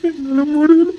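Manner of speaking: high-pitched sobbing cry. Acted. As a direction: break down sobbing on the words.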